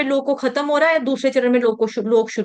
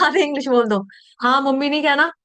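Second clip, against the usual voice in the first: ordinary delivery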